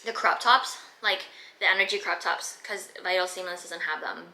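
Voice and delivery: ordinary delivery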